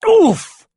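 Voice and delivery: high, loud grunt of impact; sudden high "oof". A narrator's reading. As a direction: get the wind knocked out of you. Struck in the scuffle.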